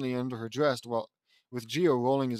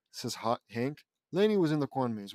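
plain reading voice

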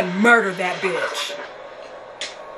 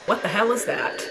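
ordinary delivery

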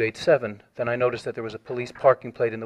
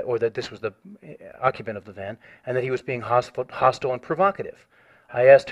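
ordinary talk, nothing marked

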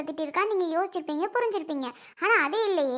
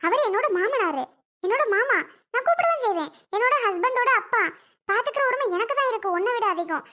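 heated high-pitched retort; 5 semitones higher than usual. Acted. Daughter-in-law claims her right to care for father-in-law, shrill high retort.

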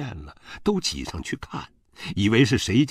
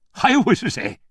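high, loud exasperated snap; angry retort; 7 dB louder than usual. A narrator's reading. Father-in-law retorts to the mother, angrily exasperated. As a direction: snap back, pitched way up.

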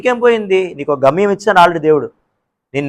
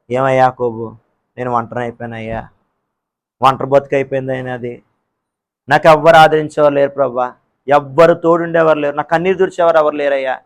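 lonely lament; 4 semitones lower than usual. Acted. Lonely believer complaining to God, low mournful lament. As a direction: sigh it out like someone all alone.

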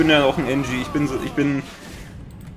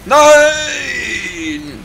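long drawn-out cry of loss, higher and louder; anguished shout; 9 semitones higher, 6 dB louder than usual. Real, not acted. Player sees his mini sentry destroyed, anguished shout. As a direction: wail as the sentry dies.

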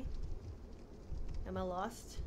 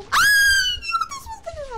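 sudden high, loud startled shout; startled shriek; 11 dB louder than usual. Real, not acted. Streamer jolted by a jump scare, shrieking.

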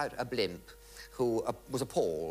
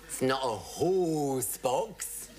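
slow comic line; much slower than usual. Retold or imitated. Sketch line, audience laughing.

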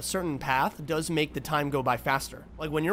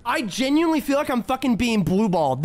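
ordinary talk, nothing marked

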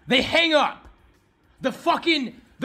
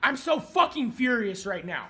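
ordinary talk, nothing marked

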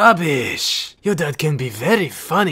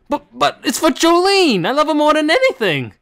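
high, loud besotted pleading; lovestruck plea; 11 semitones higher, 4 dB louder than usual. Acted. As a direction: beg like a lovesick fool.

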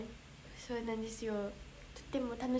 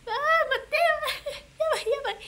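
real panic; 12 semitones higher, 5 dB louder, much faster than usual. Real, not acted. Her message text vanished on screen.

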